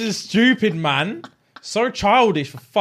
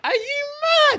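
mocking girl voice. Mocking girl asks if he's mad.

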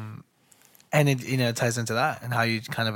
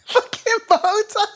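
very high, loud slow echo; mock raging boss; 5 dB louder, slower than usual. Friend echoes the Belfort bow tie line, much higher and louder. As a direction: shriek it back as the raging boss. Echoing the impression.

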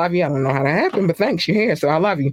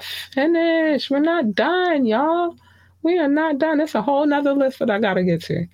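much higher, sing-song we're not done; high playful insistence; 10 semitones higher than usual. Real, not acted. Host to listeners, high sing-song insistence. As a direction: squeal it out, teasing the listeners.

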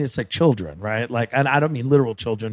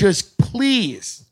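a pleading mom tone, a bit higher; fed-up motherly pilot; 7 semitones higher than usual. Retold or imitated. Comedian voicing a fed-up pilot pleading with passengers. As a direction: lightly beg like a tired mother. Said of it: "like a mother".

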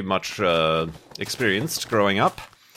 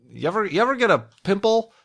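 high teasing voice; 10 semitones higher, faster than usual. Acted. Playful teasing question to viewers.

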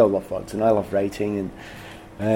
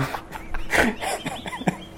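airy high-pitched laugh; high breathy laugh; 7 dB quieter than usual. Real, not acted. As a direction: let out a high wheezing laugh. Laughs at own story.

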